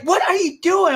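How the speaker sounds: high-pitched imitation of a scandalized partner; mock scolding hiss. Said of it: "hisses, like".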